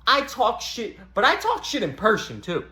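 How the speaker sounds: ordinary talk, nothing marked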